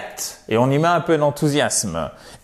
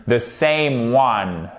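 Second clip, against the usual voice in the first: slow model phrase; 2 semitones lower, much slower than usual. Shown as a demonstration. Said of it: "teacher models the English phrase".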